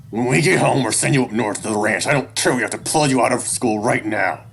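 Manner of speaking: heated parental ultimatum; angry threat. A narrator's reading. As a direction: lay down the punishment loudly. Father threatening to send child away.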